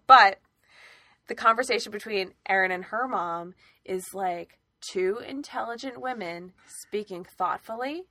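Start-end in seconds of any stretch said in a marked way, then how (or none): 4.8-8.0 thoughtful enunciation. Shown as a demonstration. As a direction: enunciate each word with exaggerated care. Imitates thoughtful, enunciated mother-daughter talk.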